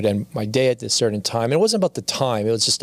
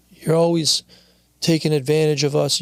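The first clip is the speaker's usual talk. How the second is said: mild mock yell, pitched up; retold yell; 5 semitones higher than usual. Wife yelling downstairs at her working husband, retold as a mild yell. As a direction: imitate a wife calling out, only slightly raised. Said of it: "she yelled downstairs".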